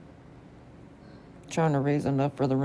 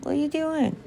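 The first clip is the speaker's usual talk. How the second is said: pet talk voice; 12 semitones higher, faster than usual. Real, not acted. Scolding dog in baby voice.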